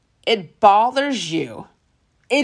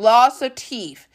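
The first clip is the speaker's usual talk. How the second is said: usual speaking voice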